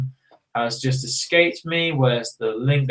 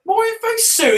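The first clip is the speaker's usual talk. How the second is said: much higher, squeaky mocking echo; mocking squeak. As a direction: parrot it back in a silly high voice. Echoing the reply mockingly.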